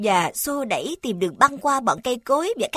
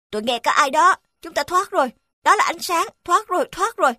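relieved excitement; 7 semitones higher than usual. Acted. Dog sees rescue light.

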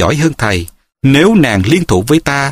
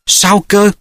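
startled question, pitched higher; trembling voice; 7 semitones higher than usual. A narrator's reading. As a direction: ask it in stunned, lightly acted dismay. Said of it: "hỏi bằng giọng run run". (giọng run run, sửng sốt)

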